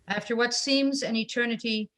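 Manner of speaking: usual speaking voice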